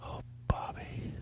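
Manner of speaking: very soft, breathy whispered lament; mock-tearful whisper. Faint mock-tearful skit aside.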